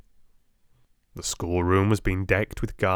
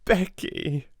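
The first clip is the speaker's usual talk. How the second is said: queer little laugh; 10 semitones higher, slower than usual. A narrator's reading. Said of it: "she cried out with a queer little laugh".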